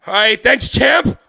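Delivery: high, loud, nervous relief; nervous photographer. Retold or imitated. Photographer thanks Mike as he walks away, high nervous squeak.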